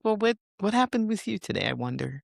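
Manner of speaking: lightly voiced curious onlooker; curious wondering. Imagined curious question to angry person.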